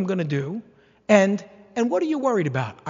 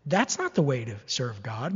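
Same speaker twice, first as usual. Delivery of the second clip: usual speaking voice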